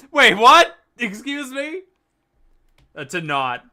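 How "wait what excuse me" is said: Wait what, jumping much higher and louder; genuinely thrown, blurt it out; startled double take. Real, not acted. Caught off guard by chat.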